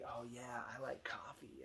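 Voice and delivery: whispered, soft secret. Retold or imitated. Daughter telling everyone she likes coffee, whispered. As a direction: breathe it like a proud secret.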